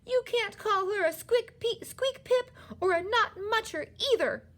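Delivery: very high, loud outrage; outraged child at full pitch; mounting anger. A narrator's reading. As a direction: scold furiously, pitch way up. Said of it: "getting angrier and angrier".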